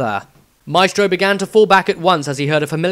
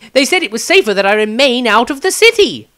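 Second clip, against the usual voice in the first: much higher, louder cocky voice; young Maestro; 10 semitones higher, 8 dB louder than usual. Acted. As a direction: still amused, pressing for an answer.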